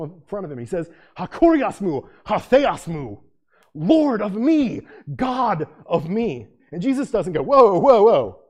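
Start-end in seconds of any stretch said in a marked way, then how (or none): 1.2-6.5 awed worship. Acted. Thomas falls before Jesus. 7.4-8.4 mock alarm. Jesus mockingly refusing praise.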